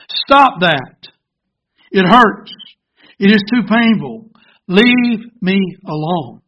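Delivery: loud, pained protest in emphatic bursts; crying out. Acted. Teacup crying out to the potter as he pounds it, loud protest. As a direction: cry out against the hurt, firm and loud. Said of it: "I cried out".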